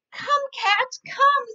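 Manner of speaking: high, loud call to the cat. Acted. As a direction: beckon the cat eagerly. Dog calls cat.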